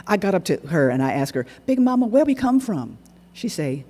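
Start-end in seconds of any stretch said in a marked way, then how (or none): 1.7-3.0 put-on boy asking Big Mama, high and eager; young boy asking. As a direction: call it up eagerly like a kid. Boy asking great-grandmother.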